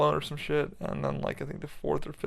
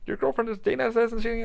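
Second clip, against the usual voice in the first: high-pitched imitation of a mom; lifted, high mock-mom voice; mom's voice; 8 semitones higher than usual. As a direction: quote your mom in a much higher voice. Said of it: "She was like".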